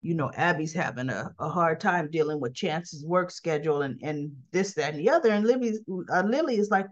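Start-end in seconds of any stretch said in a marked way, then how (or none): 0.0-5.3 lightly voiced man, pitched lower; a hint of Devon, calm and low; lowered man's voice. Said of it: "And Devon's like".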